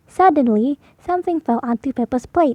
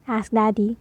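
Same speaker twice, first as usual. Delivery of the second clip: usual speaking voice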